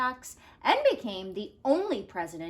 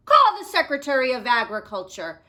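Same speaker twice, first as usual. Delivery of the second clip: loud, fast command; shouted; 8 dB louder, faster than usual. Acted. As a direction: bark the order quickly. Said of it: "shouted President Taft".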